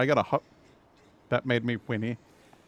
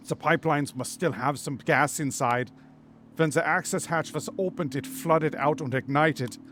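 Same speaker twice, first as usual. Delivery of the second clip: hammed-up German accent; Otto, German accent; 2 semitones higher than usual. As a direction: explain it in a comically thick German accent. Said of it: "My German accent is a joke".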